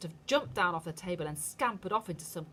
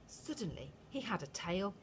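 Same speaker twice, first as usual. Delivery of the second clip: plain reading voice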